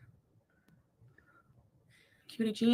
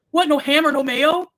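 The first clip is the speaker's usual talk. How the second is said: loud, drawn-out mock complaint; raised, incredulous whine of protest; indignant homeless man; 6 semitones higher, 8 dB louder, slower than usual. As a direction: imitate an affronted, picky beggar. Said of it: "And he's like".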